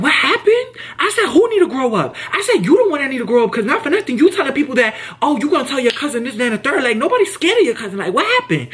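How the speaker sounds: high, heated clapback; retold confrontation. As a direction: call her out, fired up. Confronting coworker in retold argument.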